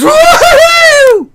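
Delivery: high, slow whooping cheer; crowd cheer. Retold or imitated. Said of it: "the crowd sort of goes".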